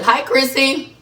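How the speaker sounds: high, loud, delighted hello; excited greeting. Real, not acted. Host greeting viewer Chrissy on live, high and excited. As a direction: call out happily to a friend.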